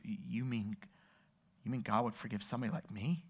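hushed, low, halting question; lip quivering. Retold or imitated. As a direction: imitate a kid near tears, barely daring to ask. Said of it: "his lip starts quivering".

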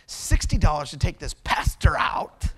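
rough, half-whispered inner fuming; seething mock mutter. Preacher retells silently fuming over a ticket, seething. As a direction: seethe under your breath, played for laughs.

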